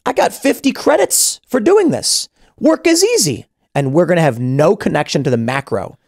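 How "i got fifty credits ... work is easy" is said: much higher, slow, cheerful naive voice; clueless happy worker. Acted. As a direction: play the oblivious gig worker, high and pleased. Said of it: "the single individual human goes".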